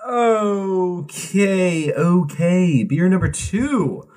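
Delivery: high, drawn-out showy intro; playful hype. Pretend. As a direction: stretch it out high to introduce the next round. Hyping up the next beer.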